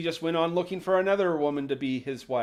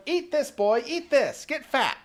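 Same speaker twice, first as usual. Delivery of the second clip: higher, pushy mock witch; coaxing witch voice; 5 semitones higher than usual. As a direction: stuff it at him like a greedy old witch.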